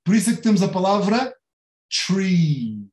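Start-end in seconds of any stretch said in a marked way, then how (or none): 2.1-2.9 tree with a drawn-out ee; long I sound. Shown as a demonstration. Teacher showing the I sound in tree, long ee. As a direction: hold the ee in tree. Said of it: "é mesmo I que se pronuncia".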